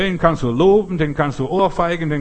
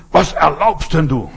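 slow, louder, offended "how dare you"; indignant retort; 6 dB louder than usual. Acted. Touchy believer snapping at whoever provoked him. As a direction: bristle at the insult.

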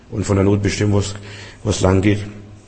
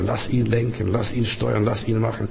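soft urging; 7 dB quieter than usual. Acted. Preacher softly urging trust in Jesus.

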